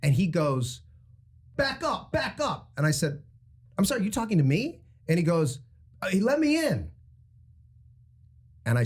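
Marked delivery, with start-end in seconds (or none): 1.6-2.7 high-pitched, curt order; imitated bossy driver. Retelling the Rolls Royce driver's demand, mock bossy. 3.8-4.8 higher, quick incredulous question; retold indignant comeback. Retelling his answer to the Rolls Royce driver, indignant. 6.1-6.9 high-pitched insistent demand; imitated demanding driver. Retelling the driver pushing into the line, mock demanding.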